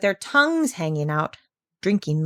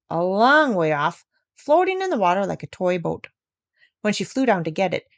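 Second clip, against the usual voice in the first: plain reading voice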